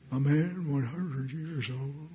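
soft, slow, quavering voice of disbelief; quivering Abraham. Acted. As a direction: quiver it quietly, drawn out for show. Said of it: "Probably quivered for emphasis".

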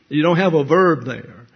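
normal talking voice

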